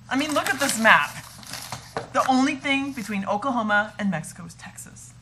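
loud, pleading with frustration; exasperated. Acted. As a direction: push back hard, exasperated. Pleading over the map.

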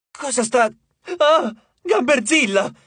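startled yell at the monster; yelling in alarm. A narrator's reading. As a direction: cut off, then scream out Gamberzilla. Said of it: "urlò Percy".

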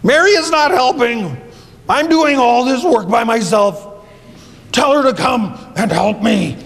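high complaining call of Jesus; upset Martha. Acted. Martha complaining to Jesus about her sister, high and whiny. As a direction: whine his name, fed up. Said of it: "Martha got upset".

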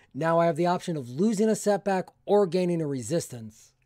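normal talking voice

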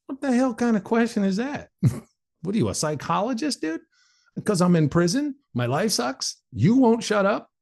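high, indignant reenactment; exasperated retort. Retold or imitated. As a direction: fire back incredulous questions, pitch way up. Retold exasperated retort to neighbour.

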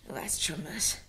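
whispered insinuation; whispering a jibe about her husband; sly whisper. Acted. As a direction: lean in and whisper it. Mocking husband before a guest.

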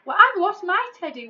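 high, loud wail; wailing giant. Acted. The giant wails to Tom about his lost teddy, high and loud. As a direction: wail it out in misery. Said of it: "wailed the giant".

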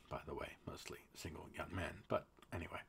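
whispered aside; conspiratorial whisper. Real, not acted. Host whispers a snide aside to viewers, hushed. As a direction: say it under your breath like a secret.

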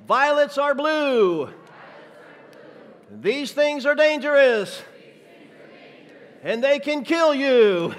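high, loud, drawn-out preacher rhyme; slow, sing-song poem in a loud high voice; impression of Fred. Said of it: "my juggling impression of Fred Staten".

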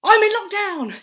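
high, very loud mock announcement; hammed-up shout. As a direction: belt out the setting to the crowd. Shouts out the improv setting.

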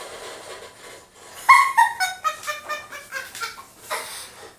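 long high-pitched giggle; high laugh. Real, not acted. Player delighted by his weapon, squealing laugh.

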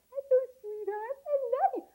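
very high, cooing doggy greeting; baby talk. Shown as a demonstration. Greeting a barking dog in baby talk. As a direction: squeal it tenderly, as to a puppy. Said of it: "if you talk baby talk to it".

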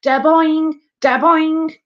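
high, forceful "da-boing" pair; springy cartoon effect. A narrator's reading. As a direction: bounce the sound out harder. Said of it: "bouncing ball got louder and louder".

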